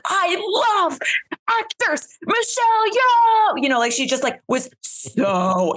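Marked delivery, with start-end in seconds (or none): 0.0-3.6 high, drawn-out gushing with a loud burst on the name; Jamie Lee Curtis impression. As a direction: gush each word slowly like an overcome star. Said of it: "I did one of Jamie Lee Curtis".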